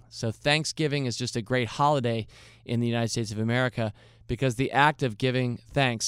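ordinary talk

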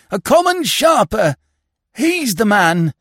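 high, loud outcry; outraged. A narrator's reading. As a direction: cry out the betrayal. Husband realises inspector was thief.

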